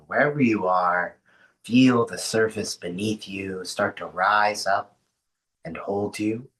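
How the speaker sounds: unhurried grounding voice with long pauses; guided meditation. Acted. Host settling an online group into rest, guided meditation. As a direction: ease them into rest, leaving space between phrases.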